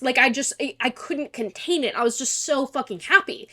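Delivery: ordinary talk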